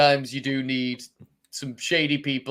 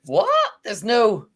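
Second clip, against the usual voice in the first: higher-pitched mock disbelief; retold surprise; 8 semitones higher, 4 dB louder than usual. Host retells watching the episode, surprised. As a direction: replay your startled reaction, pitched up.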